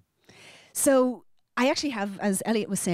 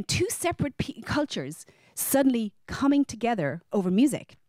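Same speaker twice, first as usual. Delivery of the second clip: usual speaking voice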